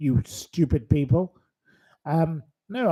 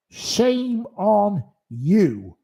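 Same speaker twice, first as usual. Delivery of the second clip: higher, heavily drawn-out scolding; slow reproach; 6 semitones higher, much slower than usual. Real, not acted. Host shames someone joking about a death, slow reproach. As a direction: stretch every word of the rebuke, pitched up. Host repeats the rebuke, slower.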